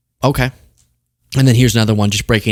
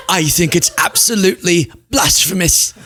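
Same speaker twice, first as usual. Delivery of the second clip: higher-pitched put-on British accent, amused; mock British accent; 6 semitones higher than usual. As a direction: mimic her as an over-the-top Brit. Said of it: "I gave her that accent".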